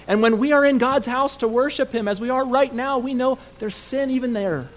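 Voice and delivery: loud, high-pitched, urgent pulpit delivery; forceful preaching. Real, not acted. Preacher confessing shared sins to the congregation, forceful preaching. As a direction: drive each charge home with force.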